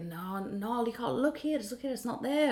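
mock-Scottish insistence; Scottish accent. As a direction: imitate a Scottish driver, pitched well up. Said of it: "I don't know why I made him Scottish".

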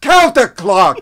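slow, loud New York shout; New York accent. Retold or imitated. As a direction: stretch out the word "Counterclock" with a New York bark. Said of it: "in his New York accent".

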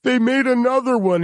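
high-pitched timid intern voice; poor intern. Acted. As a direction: break bad news to the boss, high and hesitant. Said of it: "some poor intern has to knock on his door".

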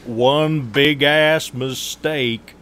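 very slow, high Southern drawl; Southern accent. Acted. Co-host imitating what Idahoans say, exaggerated Southern accent. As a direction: draw it out loudly in a put-on Southern voice. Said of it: "that sounds Southern to me".

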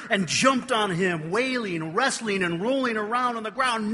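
loud and high, excited telling; retelling the wrestling, excited and loud. Real, not acted. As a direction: tumble through it loudly.

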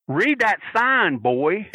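slow wrestler's threat; gruff wrestler taunt. Retold or imitated. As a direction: drawl it out like a tough old wrestler. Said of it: "and then said".

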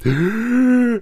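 high, sudden gasp for air; sharp imitated gasp. Mom imitating her winded son's first breath, a loud high gasp. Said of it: "he's like".

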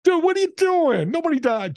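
put-on high, incredulous young guy; mock-shocked dude voice, pitched high; young clerk in disbelief. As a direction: play the baffled cashier kid. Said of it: "He's like".

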